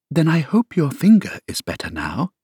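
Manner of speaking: polite. A narrator's reading. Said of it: "said very politely".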